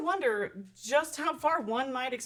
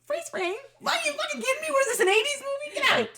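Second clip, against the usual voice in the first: high, disbelieving outburst; exasperated rant; faster than usual. Real, not acted. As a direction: vent in disbelief. Ranting about movie ending.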